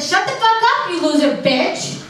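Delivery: high mock yelling at daughter; hammed-up shout at a kid. Mom yelling at daughter in waiting room, mock shout. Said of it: "I yelled at her".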